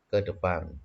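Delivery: ordinary talk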